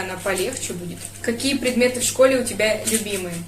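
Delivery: normal talking voice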